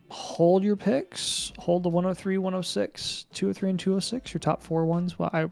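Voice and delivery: ordinary delivery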